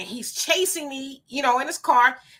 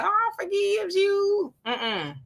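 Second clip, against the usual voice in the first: mocking; 9 semitones higher, much slower than usual. Mocking those who forgive.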